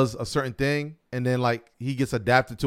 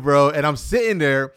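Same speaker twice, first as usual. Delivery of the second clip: usual speaking voice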